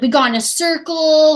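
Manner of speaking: a hunter boasting of the kill; excited. Retold or imitated. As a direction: tell it eagerly. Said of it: "They're so excited".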